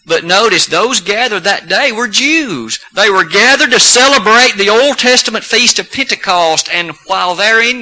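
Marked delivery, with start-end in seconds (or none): none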